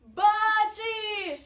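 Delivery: high, long-drawn shouts; loud, high snowflake calls; shouting. A narrator's reading. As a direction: sing it out at full volume as if flying past. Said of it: "кричала вона".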